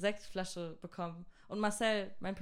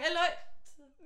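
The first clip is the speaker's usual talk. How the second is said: hohes, aufgeregtes Zurufen; nachgespieltes Rufen; 9 semitones higher than usual. Retold or imitated. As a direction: call out to the group, pitched up. Ruft die Runde an. (aufgeregt in die Runde gerufen)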